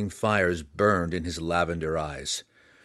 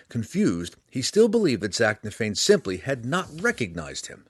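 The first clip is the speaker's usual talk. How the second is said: ordinary delivery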